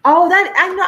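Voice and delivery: high, bright burst of recognition; oh, it clicks who she is; surprised recognition. Real, not acted. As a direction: pitch jumps up as she realizes. Recognizing a friend's mother.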